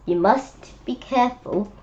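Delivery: ordinary delivery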